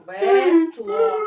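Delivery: loud, high, syllable by syllable; loud demo. Shown as a demonstration. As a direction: call out both syllables at full voice. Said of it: "Громко".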